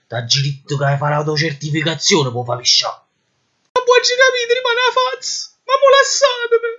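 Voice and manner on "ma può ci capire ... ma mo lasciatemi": Neapolitan clown voice. Acted. Detainee pleads again.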